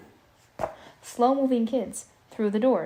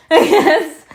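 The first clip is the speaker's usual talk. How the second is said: laughing out loud; 7 semitones higher, 14 dB louder than usual. Real, not acted. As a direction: burst of laughter. Laughing after a kid's remark.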